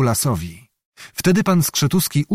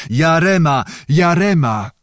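war cry; 4 semitones higher than usual. A narrator's reading. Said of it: "górował krzyk napastników".

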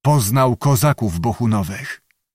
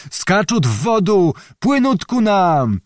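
panicked Ruthenian yell; 7 semitones higher than usual. A narrator's reading. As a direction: shout in fear with Ruthenian sounds. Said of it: "wrzeszczeli chłopi".

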